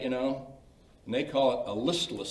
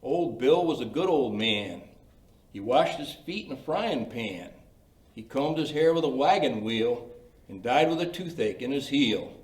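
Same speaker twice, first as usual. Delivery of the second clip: silly poem recital; 2 semitones higher, 4 dB louder than usual. Shown as a demonstration. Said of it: "read the poem".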